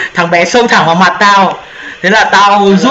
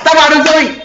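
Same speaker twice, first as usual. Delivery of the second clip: much higher, happy burst of relief; excited; 8 semitones higher, much faster than usual. Real, not acted. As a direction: celebrate getting in at last. Finally gets into the game room.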